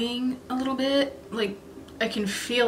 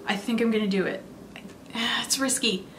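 ordinary talk, nothing marked

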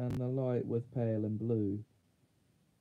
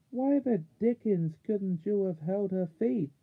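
higher-pitched, exasperated outburst; angry reproach; 6 semitones higher, 3 dB louder than usual. A narrator's reading. Eustace rebukes Puddleglum for losing Jill, angry reproach. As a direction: blame your companion, indignant, voice raised in pitch.

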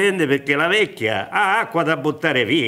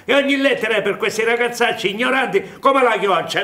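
high laughing mockery of the hen's call; mocking with laughter; 6 semitones higher than usual. Old man mocking letters that lure the young, laughing. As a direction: chuckle through the chick calls. Jacospina mocks letters like hen.

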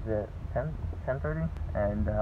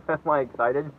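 higher, louder excited question; 6 semitones higher, 9 dB louder, slower than usual. Real, not acted. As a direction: ask it bursting with excitement. Nervous excitement before surgery.

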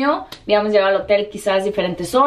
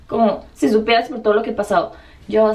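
usual speaking voice

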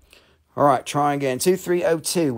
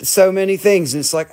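ordinary talk

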